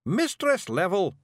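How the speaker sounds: high, slowed Scots call; Scottish mock scream. A narrator's reading. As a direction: call out urgently in a Feegle voice. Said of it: "Rob screamed".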